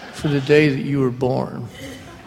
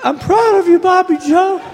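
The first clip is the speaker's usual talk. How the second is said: hammed-up dying father, pitched way up and pushed; weakened voice. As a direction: squeeze it out high and strained like a frail old man. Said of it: "in a weakened voice".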